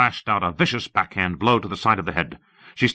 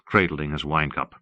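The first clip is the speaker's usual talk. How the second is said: plain reading voice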